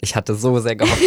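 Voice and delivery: usual speaking voice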